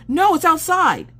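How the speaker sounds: high, loud mock-panicked yelp; hammed-up alarm; retold panicked reply. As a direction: blurt it out high and urgent. Acted panicked reply to husband.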